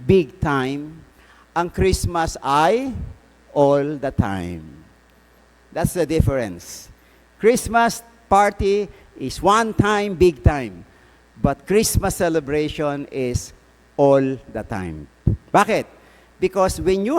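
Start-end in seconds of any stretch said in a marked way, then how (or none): 1.5-4.6 slow, weighted punchline; slow emphasis. Acted. Priest driving home his point to the congregation, slow and deliberate. 14.0-15.1 slow and deliberate, lower; slow emphasis. Acted. Priest repeating his point to the congregation, slow and low.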